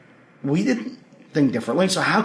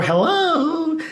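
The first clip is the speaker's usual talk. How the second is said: high, sing-out hello at a door; calling out; 12 semitones higher than usual. Retold or imitated. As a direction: imitate calling through a closed door. Calling through a knocked-on door.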